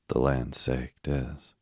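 plain reading voice

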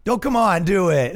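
imitating a coaxing heckler; egging on. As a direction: goad like an impatient crowd. Audience eggs him on.

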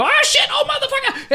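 very high, screamed cursing; hammed-up falsetto yell of pain; pained shriek. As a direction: shriek like you just got bitten. Acting out a cobra bite.

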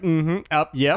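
imitating a flustered stammer; mock stammering. Host mimics co-host caught out on the call, stammering. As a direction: stumble over little agreeing noises. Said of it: "like, stammering".

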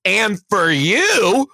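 loud, high, drawn-out call-out; going off on kids. Retold or imitated. Teacher turning on another kid, loud berating. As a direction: turn on the next culprit, slowly and loudly. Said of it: "going on these kids".

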